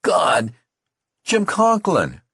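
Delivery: high, loud cry of horror; horrified scream. A narrator's reading. Youth screams on recognizing his dying friend. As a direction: shriek his friend's name in shock. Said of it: "the youth screamed".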